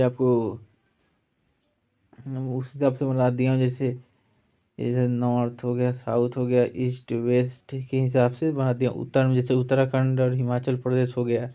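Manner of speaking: ordinary talk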